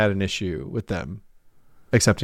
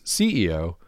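ordinary delivery

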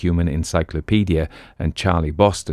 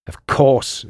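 curt, higher snap; snapped; 7 semitones higher than usual. Acted. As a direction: bite the words off impatiently. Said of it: "snapped the manager".

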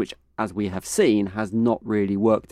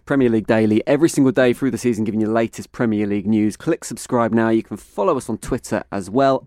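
upbeat host sign-off plug; podcast outro; 3 semitones higher, 5 dB louder, faster than usual. Acted. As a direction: wrap up the show like a host.

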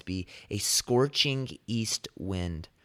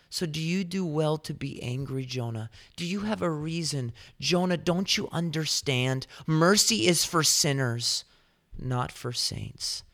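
God questioning Jonah; 6 semitones higher than usual. Retold or imitated. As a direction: press the question home, then ease off. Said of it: "this question".

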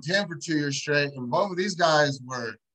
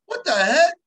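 "What the heck?" higher and louder, amazed; 9 semitones higher, 7 dB louder than usual. Retold or imitated. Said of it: "And I'm like".

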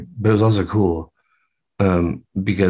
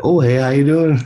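friendly greeting; 6 semitones higher, 4 dB louder than usual. Retold or imitated. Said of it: "he's, like, a good Canadian gentleman".

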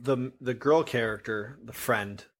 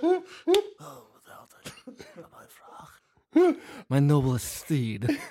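Uncle Rumple impression; 3 semitones higher than usual. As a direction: ham it up as a strange movie creature. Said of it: "You try yours?".

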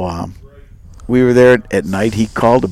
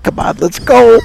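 higher-pitched mock urging; retold straining to get going; impatient; 11 semitones higher, 3 dB louder than usual. Retold impatience while holding the bow.

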